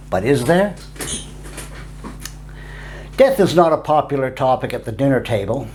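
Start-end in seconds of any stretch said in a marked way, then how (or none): none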